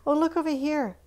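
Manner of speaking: surprised. Real, not acted. Pointing out missing shoe.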